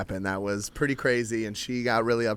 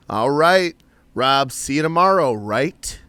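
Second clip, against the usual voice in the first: voiced coworker, louder and pointed; slightly louder mock coworker asking about tomorrow; coworker checking up; 3 semitones higher, 7 dB louder, slower than usual. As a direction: press him about tomorrow, a bit louder. Coworker voiced pressing him.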